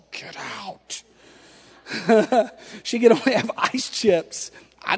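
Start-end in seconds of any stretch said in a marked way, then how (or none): 0.1-1.0 much softer, whispered hiss; wife in labor sends husband away, whispered; whispered get out. Retold or imitated. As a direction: breathe it out like a final warning.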